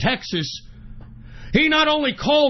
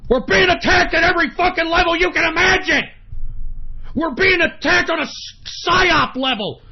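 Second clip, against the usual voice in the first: furious shouting; 4 semitones higher than usual. Real, not acted. Host shouts to his listeners about attacks, furious yell. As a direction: rage at being attacked. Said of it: "the reason I'm getting so pissed off".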